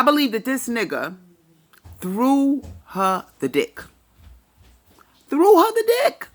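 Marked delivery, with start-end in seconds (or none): 2.0-3.8 slow, spaced-out words; measured and deliberate, word by word; slow emphasis. Real, not acted. Repeats the phrase for emphasis. 5.3-6.3 high, loud exclamation; loud repeat leaping high at the end; emphatic repeat. Real, not acted.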